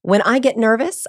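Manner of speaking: ordinary talk, nothing marked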